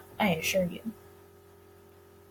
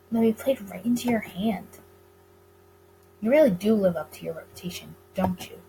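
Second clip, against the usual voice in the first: ordinary talk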